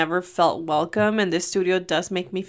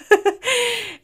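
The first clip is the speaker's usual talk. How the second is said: high laugh; 13 semitones higher than usual. Real, not acted. Laughing at the joke.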